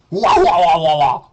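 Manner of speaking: loud sneering wah wah; mock crying wah. Mocking Hussy as a crybaby, loud sneering wah. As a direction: bawl it out to mock him.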